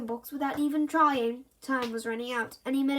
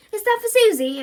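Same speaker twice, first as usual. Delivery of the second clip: put-on high, louder boy's voice; voiced boy; 9 semitones higher, 11 dB louder than usual. A narrator's reading. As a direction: play the eager boy. Bertie sweetly asking Mum.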